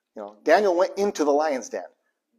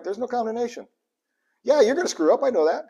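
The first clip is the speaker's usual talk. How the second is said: higher, lightly voiced God; God reassuring; 10 semitones higher than usual. God telling the sinner he's forgiven, lightly voiced and higher. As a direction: play God, easygoing and kind. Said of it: "But God's like".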